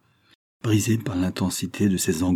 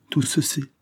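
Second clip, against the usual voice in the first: repeated even higher, broken; stammered despair; 7 semitones higher than usual. A narrator's reading. Valentine repeats it higher, stammering in shock. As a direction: let the words crack upward in despair. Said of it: "balbutia Valentine".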